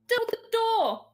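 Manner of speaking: high, loud yelled order; in-character shout. Acted. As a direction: shout it across to a companion. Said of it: "can Nim shout to Kozar".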